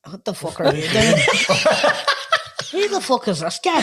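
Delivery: laughing mock disbelief. Laughing at the kneeling friend, mock outrage. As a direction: giggle out his stunned reaction. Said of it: "looked at him as if".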